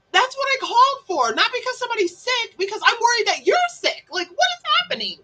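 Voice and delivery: high-pitched, insistent protest; worked-up, urgent and high; exasperated concern. Retold or imitated. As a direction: plead your case in exasperation. Acted exasperated explanation to client.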